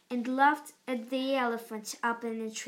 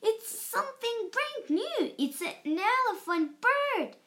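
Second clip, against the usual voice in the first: shouted; 9 semitones higher than usual. A narrator's reading. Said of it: "they all shouted".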